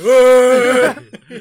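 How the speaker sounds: mock yelling. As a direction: yell at her from the back. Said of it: "dando gritos de fondo". (gritos de fondo a la señora)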